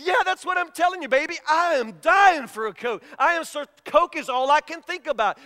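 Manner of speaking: high, loud, mock-desperate pleading; eager desperation. Husband telling wife he wants a Coke, acted desperation. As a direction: insist excitedly, as if dying of thirst.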